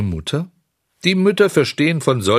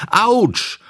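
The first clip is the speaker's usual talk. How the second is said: sharp high yelp of pain, louder; 13 semitones higher, 3 dB louder than usual. Acted. Kicked schoolboy yelping in pain under the bench. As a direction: cry out as the shin gets kicked. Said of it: "schrie der Getroffene".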